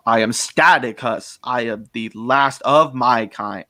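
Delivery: slow, heavily emphasised alien villain; grand, slow character declaration; Staticus. Shown as a demonstration. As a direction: draw out each word, stressing "Staticus" and "last". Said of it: "I recorded that where it's like".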